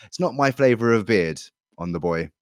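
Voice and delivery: ordinary talk, nothing marked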